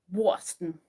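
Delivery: Boston with a rounded first vowel; Bostonian accent. Acted. Trying out Bostonian for the chat, Bostonian accent. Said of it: "Bostonian accent".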